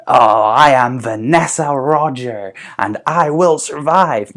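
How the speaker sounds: slow, loud, theatrical declaration; slow dramatic villain voice; grand villain reveal. Acted. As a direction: announce it like a stage villain. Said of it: "she'll say".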